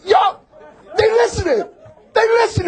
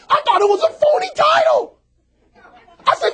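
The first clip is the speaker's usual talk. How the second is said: usual speaking voice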